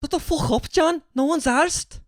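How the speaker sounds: high-pitched aggressive Scouse imitation; mock-angry Scouser, voice pitched high. Shown as a demonstration. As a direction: tell someone off in put-on Scouse. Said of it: "in a very aggressive Scouse accent".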